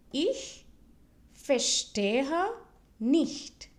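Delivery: slow German, a pause after each of "Ich", "verstehe", "nicht"; word by word. Shown as a demonstration. Teacher models the phrase for learners to repeat, word by word. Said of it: "repeat it twice so that you can listen".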